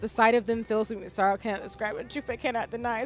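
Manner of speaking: low, soft dramatic reading; reading script aloud. Acted. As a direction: read the lines quietly and solemnly. Reads game script aloud.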